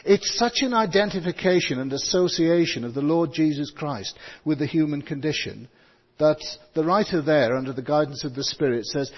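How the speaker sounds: usual speaking voice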